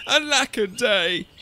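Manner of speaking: high, slow lament; drawn-out storybook woe; mournful cry. A narrator's reading. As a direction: wail it theatrically. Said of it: "And he cried".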